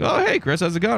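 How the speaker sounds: imitated cheery boss; cheerful greeting. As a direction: greet an old friend brightly. Owner greets him cheerfully.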